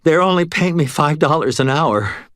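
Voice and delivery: high, put-upon grumble; grumbling. A narrator's reading. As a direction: complain it sourly. Cashier grumbling.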